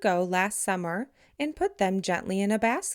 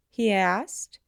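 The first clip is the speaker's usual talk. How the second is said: plain reading voice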